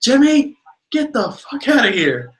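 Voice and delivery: loud exasperated outburst, imitated; mock-angry bark to chase someone off; fed-up Gene. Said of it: "keeps getting in his grill".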